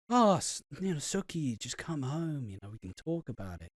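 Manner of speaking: soft, low, smooth-talking Nish; smooth little voice. Retold or imitated. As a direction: coax her home in a lightly voiced, hushed smooth tone. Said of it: "That smooth little voice he does".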